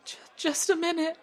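high, loud, choked call through the door; a flustered mother calling out mid-sob; tearful mother. Acted. Mother startled at the door, calling out tearfully. Grieving mother answers the knock.

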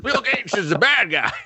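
high-pitched mock jeer; mock heckling. Crowd jeering at a movie villain, mock heckling.

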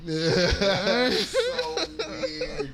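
real laughter breaking up the words, high and loud; cracking up, dragging the words out through laughter; helpless laughing. Real, not acted. Cracking up over relatives' comments.

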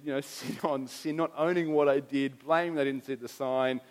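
normal talking voice

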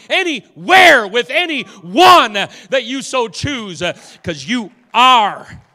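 loud, high, impassioned pleading; full-force preached prayer. Real, not acted. Preacher begging Jesus to help unbelief, fervent loud prayer. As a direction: cry out to God with long, punched key words.

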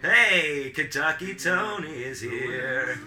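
slow, drawn-out announcing of an arrival; calling out a welcome. Real, not acted. Host hailing a guest walking into podcast, drawn-out call. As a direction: hail the newcomer, stretching out every word. Greeting a guest who walks in.

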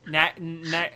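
normal talking voice

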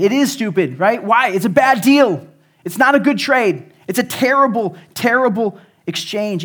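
loud, high-pitched preacher's punch; sermon shout; preaching at full force. Acted. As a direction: hammer home the bad deal to the congregation.

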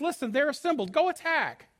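high, urgent battle order; Deborah. Acted. Prophetess sends hesitant man to attack, high and urgent. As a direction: push the command upward to rouse him. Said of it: "And then she says".

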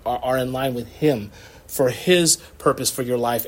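ordinary talk

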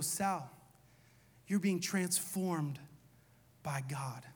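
soft, slow and reassuring; quiet pastoral reassurance; tender. Retold or imitated. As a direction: speak gently to a shaken friend. Pastor gently tells Sal.

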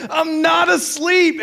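very high, slow, loud denial; acted screaming. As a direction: yell it indignantly, stretching the words. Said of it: "You are screaming".

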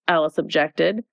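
ordinary delivery, nothing marked

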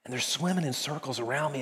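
ordinary delivery

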